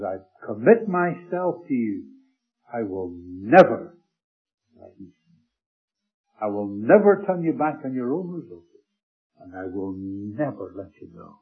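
slow, swelling then hushed vow; the Lord's tender pledge, slowed down; reassuring promise. Acted. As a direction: promise it, then let it fall to a hush.

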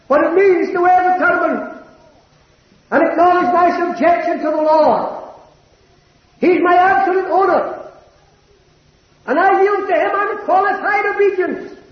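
pitched high and ringing, a fervent pulpit cry; preaching at full force. Acted. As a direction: lift the whole confession up high like a sermon climax.

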